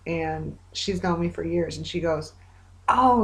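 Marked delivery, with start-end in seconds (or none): 2.9-3.2 a high, louder gasp of surprise, mimicking the coworker; retold mock surprise, pitched up; surprised oh.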